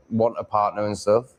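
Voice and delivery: ordinary talk, nothing marked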